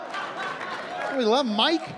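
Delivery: very slow, high lovestruck "I love Mike"; swooning drawl. Acted. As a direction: drag it out dreamily. Drawn-out swooning line.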